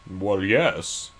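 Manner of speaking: British accent, lightly put on; reply in a mock British accent; [name]. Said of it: "Can you do a British accent?".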